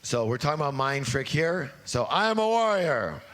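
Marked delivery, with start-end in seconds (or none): 2.1-3.2 loud declaration. Shown as a demonstration. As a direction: shout it out, strong and high. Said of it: "please stand up for the declaration".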